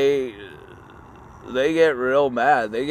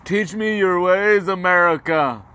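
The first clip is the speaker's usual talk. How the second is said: high, pleading put-on voice, louder; pleading Hitler; 8 semitones higher, 4 dB louder than usual. Hitler begging America to teach him, high mock plea. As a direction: beg in a squeaky high mock voice. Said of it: "Hitler was just like".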